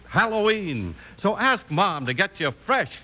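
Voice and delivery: usual speaking voice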